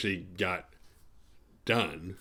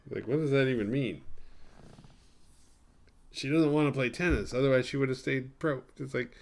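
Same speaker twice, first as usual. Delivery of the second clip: ordinary talk, nothing marked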